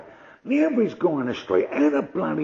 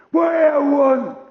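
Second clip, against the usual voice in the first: high, slow, loud bark; aggressive shout; 10 semitones higher, 5 dB louder, much slower than usual. Retold or imitated. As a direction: roar at the stranger. Big man shouting from car.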